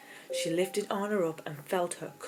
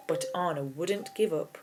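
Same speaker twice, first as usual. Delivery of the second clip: ordinary delivery, nothing marked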